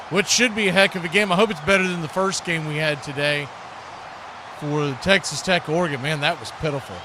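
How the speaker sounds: normal talking voice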